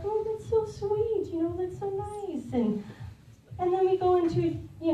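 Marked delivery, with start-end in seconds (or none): 0.0-2.5 sweet gush. Retold or imitated. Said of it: "I'm like".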